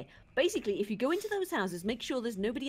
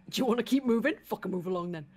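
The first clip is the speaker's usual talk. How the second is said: menacing thug; 8 dB louder than usual. Acted. Voicing threatening NPC.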